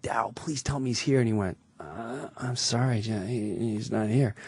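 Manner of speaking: soft hushed voice; whispered retelling. Retold or imitated. Comic whispers to castmate onstage, softly hushed. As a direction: hush it like onstage mid-sketch. Whispering on stage mid-sketch.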